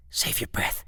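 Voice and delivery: hushing a friend; whisper. Acted. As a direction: whisper it to him aside. Hushing friend before stranger.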